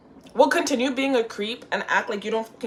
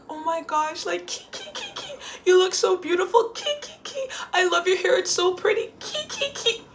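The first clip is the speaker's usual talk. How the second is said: high, giggling gush of compliments; giggling fans; 11 semitones higher than usual. Retold or imitated. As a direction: gush through nervous giggles. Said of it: "nonstop laughing while talking to me".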